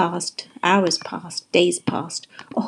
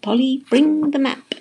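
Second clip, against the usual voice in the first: raised, higher-pitched call; yelling; 6 semitones higher, 3 dB louder than usual. A narrator's reading. Pete to Polly leaving the boat, yelling. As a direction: call out the order. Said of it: "Pete yelled".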